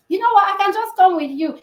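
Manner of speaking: the husband brightly inviting himself along; husband acting casual. Husband insisting to his wife on joining her, high and eager.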